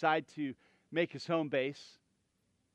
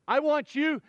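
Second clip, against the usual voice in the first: urgent command; 6 semitones higher, 5 dB louder than usual. Acted. Voicing prophet's urgent order.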